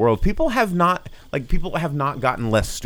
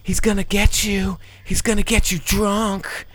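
creepy doll voice; 8 semitones higher than usual. Pretend. Voicing the tiki figure.

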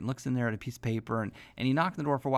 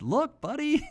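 excited reveal; 4 dB louder, slower than usual. Retold or imitated. Father shows son a surprise.

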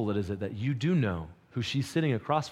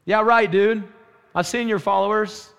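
mocking retort; 8 semitones higher, 7 dB louder than usual. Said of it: "They're like".

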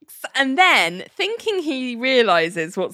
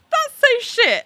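squeaky disbelief, pitched way up; high incredulous squeak; 13 semitones higher than usual. Retold or imitated. Telling friends about the show, high outraged squeak. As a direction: shriek it in disbelief. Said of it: "I was a bit like".

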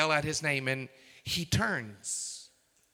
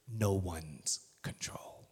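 whisper; 8 semitones lower, 6 dB quieter, slower than usual. Acted. Sermon point dropped to whisper.

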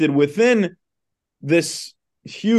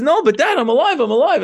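high, eager protest, retold; high-pitched, thrilled insistence; excited Pinocchio; 10 semitones higher, 4 dB louder, faster than usual. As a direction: act out a boy insisting to his dad with joy. Pinocchio insisting to father he lives.

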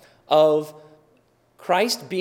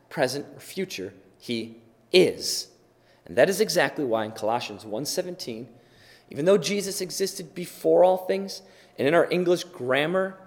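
ordinary talk, nothing marked

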